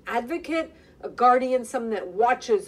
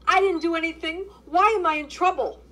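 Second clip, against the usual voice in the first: loud, high panicked cry; frightened cry; 7 semitones higher, 4 dB louder than usual. Acted. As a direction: cry out like a cornered girl. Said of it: "she cried out".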